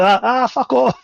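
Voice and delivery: high jeering gloat, imitating himself; gloating taunt. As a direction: rub it in like a poor winner. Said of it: "I was a poor winner that day".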